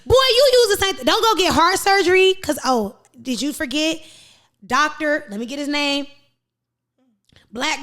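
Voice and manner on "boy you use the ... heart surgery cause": raised rant. Real, not acted. Heated rant.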